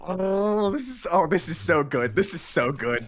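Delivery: real delight. Real, not acted. Co-writer thrilled by the scene, loud delight. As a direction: gush with excitement. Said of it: "delight at the scene".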